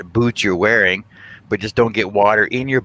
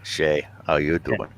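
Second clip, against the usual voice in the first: sexy voice; 5 semitones lower than usual. Pretend. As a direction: lower sexy voice for a pickup line. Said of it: "No, it's my sexy voice".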